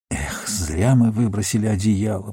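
soft, understated regret; quiet note of regret; regretful. A narrator's reading. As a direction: sigh it out softly, a touch rueful. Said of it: "пожалел он".

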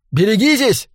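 high-pitched, urgent call; cried warning. A narrator's reading. Narrator warns Humboldt of the knife, crying out. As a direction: call out in sudden alarm. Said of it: "крикнул я Хамблду".

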